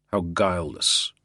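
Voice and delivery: ordinary delivery, nothing marked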